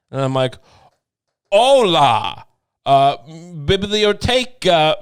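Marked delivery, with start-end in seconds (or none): 1.5-5.0 loud, halting fake Spanish accent; bad Spanish accent. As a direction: badly pretend to be Spanish, drawn out and loud. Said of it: "I pretend to be Spanish".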